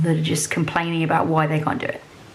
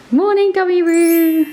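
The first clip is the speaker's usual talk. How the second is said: cheery greeting; 10 semitones higher, 7 dB louder than usual. Real, not acted. Greeting partner playfully.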